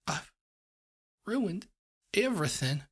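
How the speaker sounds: high, breaking sob; sobbing. A narrator's reading. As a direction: cry the words out. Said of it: "she sobbed into her hooves".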